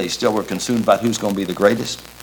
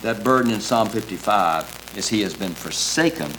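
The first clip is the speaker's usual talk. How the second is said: usual speaking voice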